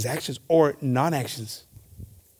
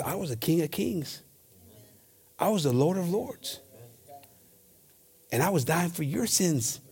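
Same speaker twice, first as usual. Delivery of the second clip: soft voice of Jesus; 7 dB quieter than usual. Acted. Jesus voiced in scripture reading.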